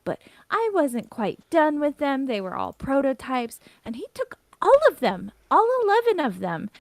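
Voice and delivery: high, loud indignant complaint; really huffy. Acted. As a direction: build to an outraged high peak on the grievance. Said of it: "again starts getting really huffy".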